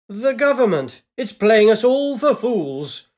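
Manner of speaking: loud, high, blustering outburst; big man bellowing his grievance; outraged shout. A narrator's reading. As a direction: shout it out, stretching the words in indignation. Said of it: "the man shouted".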